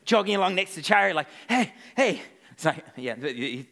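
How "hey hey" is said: higher-pitched call to a passer-by; acted "hey" to get attention. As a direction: hail someone riding past. Calling to moving chariot while jogging.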